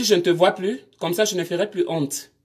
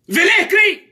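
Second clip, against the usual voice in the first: challenging shout; 11 semitones higher, 5 dB louder than usual. Real, not acted. Live, scolding silent viewers.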